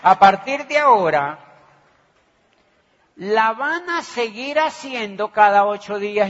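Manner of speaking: loud, punchy repeat of the new rule; emphatic repeat. Retold or imitated. Telling the recruit it now happens weekly, loud and emphatic.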